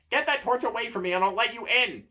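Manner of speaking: high, loud urgent demand; frantic bargaining, pitched high; panicked door. Acted.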